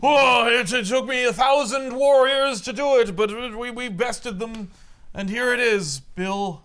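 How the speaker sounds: high, loud boastful god; proud Odin. Retold or imitated. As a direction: present the hammer like a triumphant king. Odin voiced presenting the hammer.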